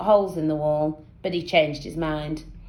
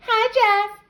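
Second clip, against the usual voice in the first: put-on girl voice; 4 dB louder than usual. A narrator's reading. Girls greeting Jeff.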